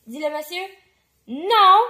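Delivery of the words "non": higher, much louder no; shouted refusal. A narrator's reading. Dad arguing with the cashier, shouted no. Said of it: "crie le papa".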